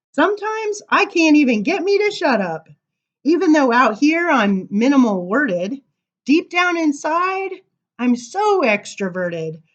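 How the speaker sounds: bright, lilting read-aloud voice; reading to children. Acted. Reading a funny giraffe poem to children, sing-song. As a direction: read the rhyme playfully to the kids.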